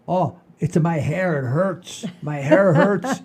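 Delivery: hammed-up dad moaning that his hair hurts; imitating his Sicilian father. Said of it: "like this and go".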